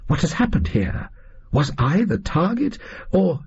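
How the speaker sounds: a startled Watson, pitch climbing; alarmed question. A narrator's reading. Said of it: "I said".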